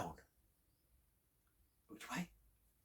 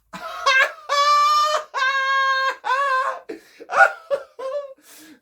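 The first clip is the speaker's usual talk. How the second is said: loud, high, long hammed-up laughter; hearty belly laugh; 12 dB louder than usual. A narrator's reading. Chipmunk rolling on the floor at Bear, hearty laughing. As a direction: roar with helpless belly laughter. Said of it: "a big hearty belly laugh".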